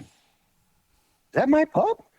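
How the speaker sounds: high, loud startled question; Joe surprised. Retold or imitated. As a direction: squeak it out in disbelief. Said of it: "He said".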